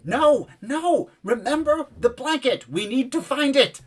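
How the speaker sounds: very high-pitched urgent cry; Elkie yelling. Acted. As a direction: plead loudly in a high, put-on voice. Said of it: "you hear Elkie yelling".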